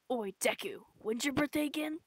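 usual speaking voice

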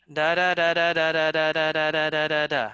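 mechanical monotone rattle; steady machine-like syllables, no variation; unvaried pace. Shown as a demonstration. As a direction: drone on evenly on one note. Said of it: "Vary your speed".

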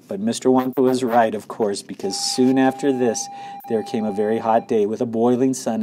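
usual speaking voice

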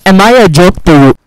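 loud, hammed-up indignant shout; over-the-top offended yell; mock outrage. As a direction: play insulted at the game. Mock outrage at bad loot.

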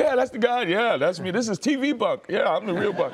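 loud, high-pitched excited boasting; proud, excited showing-off. Retold or imitated. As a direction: crow it to the whole room, imitating a delighted man. Acts out Bunk proudly showing off his actor.

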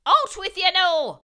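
high, loud leprechaun command; shouted order. Acted. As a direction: bark him out from behind the rock.

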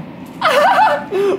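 high-pitched burst of laughter; sudden high giggle. Real, not acted. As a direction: laugh out high while still falling. Streamer laughs while falling in game.

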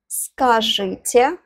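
usual speaking voice

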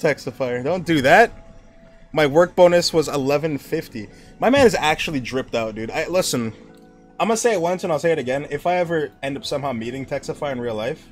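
ordinary talk

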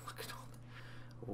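whispering. Real, not acted. Quietly musing over game menu.

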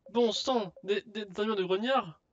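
shocked merchant. Acted. Merchant hears a request for grunt armour, shocked. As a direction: blurt it out, taken aback.

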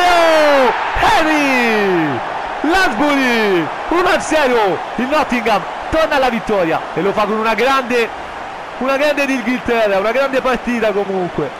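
very high, very loud goal scream; breathless italian goal commentary. Acted. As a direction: scream the goal at full force.

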